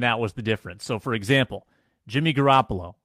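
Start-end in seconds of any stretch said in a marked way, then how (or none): none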